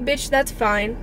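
ordinary delivery

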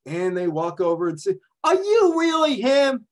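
high, gushing fan voices, a bit loud; two starstruck women sidling up to a celebrity; excited Bronx ladies. Pretend. Two Bronx women approaching Joe Namath, high and gushing.

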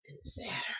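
unvoiced whispered aside. Real, not acted. Reader whispering Saturn's name because she dislikes it.

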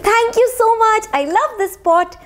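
put-on high-pitched grateful boy; acted high, happy thanks; delighted boy. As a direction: thank her in an excited child's voice. Boy thanks woman for pot.

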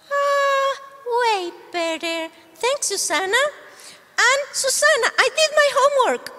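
high, bright little dog voice; puppy puppet. Acted. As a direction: play the eager puppy.

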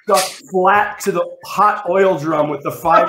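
ordinary talk, nothing marked

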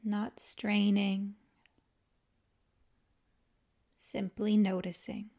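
calm, unhurried yoga nidra guidance with long pauses; guided meditation. Acted. Teacher guiding listeners to let the body melt, calm guided meditation. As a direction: ease them into rest, leaving space after each phrase.

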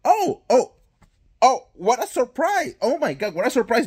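high, sarcastic oh what a surprise; mock surprise. Vlogger mocking the ratings claim, sarcastic mock surprise. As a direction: act amazed, dripping with sarcasm.

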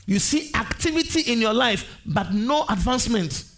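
high-pitched sermon declamation; impassioned preaching. Real, not acted. Preaches at full force.

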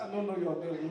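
small humble low voice; meek reply. Retold or imitated. His meek reply to her demand.

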